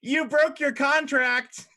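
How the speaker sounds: high, loud, gloating imp. Acted. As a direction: crow over the broken deal. Imps argue contract.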